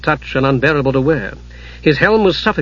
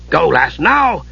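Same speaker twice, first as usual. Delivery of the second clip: dwarf voice; slower than usual. Acted. Dugan voiced.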